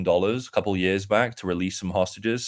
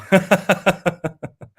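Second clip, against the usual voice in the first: a sudden high cackle of laughter; high burst of laughter; 10 semitones higher, 6 dB louder than usual. Real, not acted. Laughing hard at the buy-signal joke, high cackle. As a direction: laugh out loud, pitched way up.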